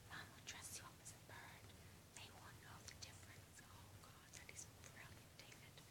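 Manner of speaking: hushed conspiratorial whisper; whispered plotting. Retold or imitated. David whispers his plan to Mom, hushed. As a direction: whisper it like a secret.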